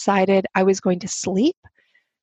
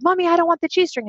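mimicking her little daughter; 8 semitones higher, faster than usual. Daughter voiced in retold scene.

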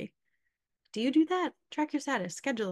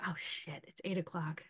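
low, much softer aside to oneself; muttered realization; 4 semitones lower, 10 dB quieter than usual. Retold or imitated. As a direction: mutter it under your breath, lower. Acts out remembering a promised workout.

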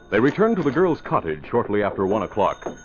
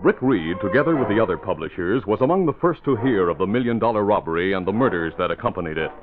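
lower, steady story narration; radio drama narrator; 3 semitones lower than usual. Acted. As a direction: set the scene calmly.

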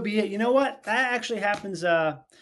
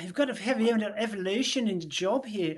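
earnest Aussie manager impression; Australian boss. Café manager urging Robbie to stay longer, Australian accent. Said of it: "Literally, that was him".

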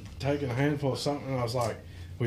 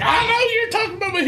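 startled shout; faster than usual. Retold or imitated. Woman popping out of the back seat, shouting high. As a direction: yell it high, catching him out.

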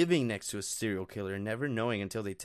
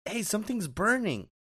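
mild mock yell, pitched up; higher-pitched retold call-out; 7 semitones higher than usual. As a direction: imitate calling out a warning without real volume. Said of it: "I yelled at my sister".

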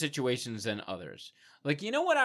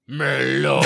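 put-on loud, stretched-out awful French accent; bad French accent; 8 dB louder than usual. Defying sister who said don't, bad French accent. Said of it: "words in a bad French accent".

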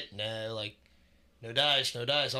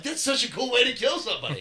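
excited; 10 semitones higher, 5 dB louder than usual. Retold or imitated. Retold excited reaction to Saw.

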